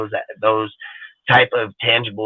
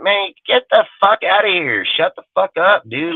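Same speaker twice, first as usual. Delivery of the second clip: pitched up, fed-up rant; real irritation, pitched up; exasperated outburst; 7 semitones higher than usual. Real, not acted. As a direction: tell them to shut it, fed up. Angry outburst at old-timers.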